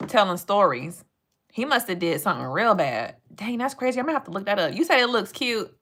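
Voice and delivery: ordinary delivery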